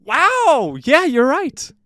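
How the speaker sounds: high, loud burst of fake astonishment; mock amazement. Host to guest after a joke guess, mock amazed. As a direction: shout it like a jackpot answer.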